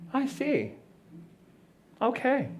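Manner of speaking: soft, high, detached acknowledgment; serene from the throne. Acted. Listening from the throne, soft and unbothered. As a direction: receive it softly from a throne. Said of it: "I just sit on my throne and I just go".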